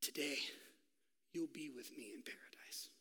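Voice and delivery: soft, tender whisper. Acted. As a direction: promise it gently, barely voiced. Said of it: "Jesus looks at him and he says".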